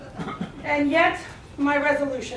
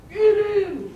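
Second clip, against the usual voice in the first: tiger mobster; 4 semitones higher than usual. Acted. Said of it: "And he said".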